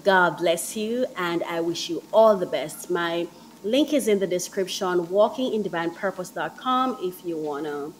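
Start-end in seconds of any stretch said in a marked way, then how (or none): none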